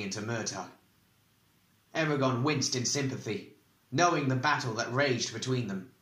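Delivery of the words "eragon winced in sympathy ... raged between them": plain reading voice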